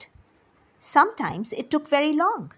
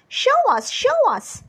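high, loud, excited plea; eager begging; 12 semitones higher, 5 dB louder than usual. A narrator's reading. Boys beg father to show his gift, eager pleading. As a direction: clamour like impatient children, pitched way up.

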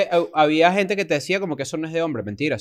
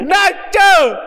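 loud, high mock call-out; shouted greeting; 13 semitones higher, 6 dB louder than usual. Friend acting out a greeting to Nacho, exaggerated shout. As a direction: holler the name like hailing a pal. Calls out another sample greeting.

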